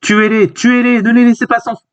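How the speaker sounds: high-pitched frantic command; urgent acted order. Character ordering men to kill the fugitives, high urgent calls. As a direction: pitch it high and urge the pursuers on.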